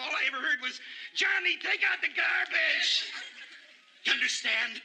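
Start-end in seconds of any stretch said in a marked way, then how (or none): 1.1-3.1 high, slow imitation of a screaming parent; parent yelling. Man imitating his yelling parents, high slow holler. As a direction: holler it slowly like a nagging parent. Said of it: "my mother and father yelled and screamed".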